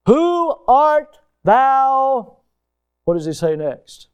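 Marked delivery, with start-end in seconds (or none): 0.1-2.4 drawn out, high and louder; a stunned man asking the blinding light who it is; slow awed question. Retold or imitated. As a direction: stretch each word as if stunned. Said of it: "he says".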